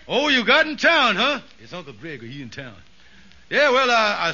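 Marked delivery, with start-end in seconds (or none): 1.6-2.8 hushed aside. Acted. Aside to wife during phone call.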